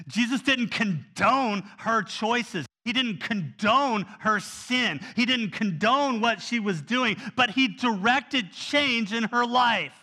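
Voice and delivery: slow emphatic sermon build; forceful preaching. Acted. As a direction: drive each line home from the pulpit.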